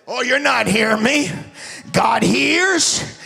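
preaching at full force. Real, not acted. Preacher roaring to his congregation, loud and high. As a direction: shout it out to the church.

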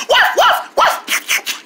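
high, short puppy barks; two quick high woofs; dog barking. Acted. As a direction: yap like a little dog. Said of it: "He likes it".

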